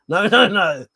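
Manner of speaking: a slipped-in Welsh lilt on the no's; mock Welsh accent. Imitating the corner shop man refusing in a Welsh accent. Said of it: "I may have been putting on an accent".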